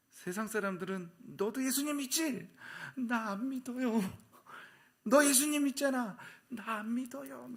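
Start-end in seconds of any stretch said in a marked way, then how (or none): 1.4-2.5 very high mock interrogation; accusing question. Acting worldly people questioning a believer. 3.0-4.0 high, drawn-out protest; mock denial. Acting out a believer's denial. 5.0-6.2 loud, very high accusation; pressing accusation. Acted. Acting worldly people pressing a believer. 6.5-7.5 high, slow wheedling denial; mock denial. Acting out a believer's repeated denial.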